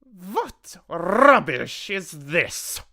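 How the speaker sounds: slow, high, loud scorn; Grand High Witch's shout. A narrator's reading. Grand High Witch dismisses Mildred's claim, slow scornful shout. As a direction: shout it imperiously, drawing out each word. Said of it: "shouted the Grand High Witch".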